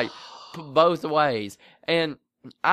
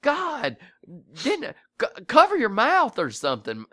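exasperated scolding; 6 semitones higher than usual. Retold or imitated. Retelling scolding his daughter.

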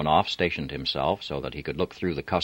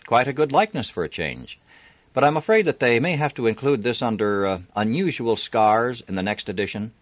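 higher, chatty and bright; breezy young woman; 7 semitones higher, 4 dB louder than usual. A narrator's reading. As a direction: play it light and cheeky. Robin joking with officer.

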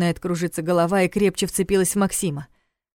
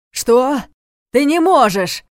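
loud high furious shout; 7 semitones higher, 8 dB louder, slower than usual. A narrator's reading. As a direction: shout in disbelief. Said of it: "крикнул он".

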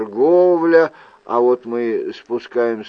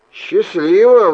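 sighed farewell; 7 semitones higher, 7 dB louder than usual. Acted. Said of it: "вздохнул я".

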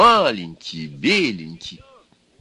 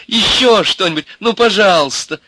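put-on high, loud girlish begging; eager pleading; 12 semitones higher, 7 dB louder than usual. A narrator's reading. Milka begging Ivan for more, eager. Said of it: "попросила она".

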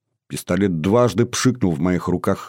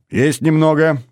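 ordinary delivery, nothing marked

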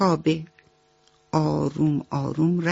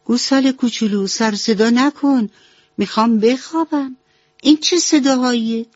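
a higher, irritated mouse voice scolding; annoyed mouse; 6 semitones higher, 3 dB louder than usual. A narrator's reading. Mouse woken by chewing, scolds the calf, irritated higher voice. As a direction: scold the noisy calf, half-asleep and cross. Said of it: "چشماشو باز کرد و گفت".

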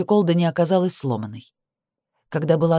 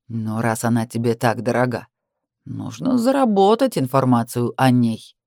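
mocking sneer; 5 semitones lower than usual. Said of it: "глумливая ухмылка".

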